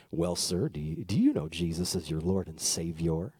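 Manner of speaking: put-on very low, measured old-man voice; solemn low voice of an old preacher; old pastor. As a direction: drop way down like an elderly pastor questioning someone. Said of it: "in the most old".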